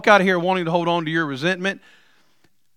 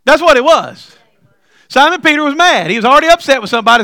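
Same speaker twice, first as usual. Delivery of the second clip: louder, faster push; emphatic preaching; 7 semitones higher, 6 dB louder than usual. Real, not acted. Preacher insisting Peter was already mad, loud and fast. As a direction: drive it home fast.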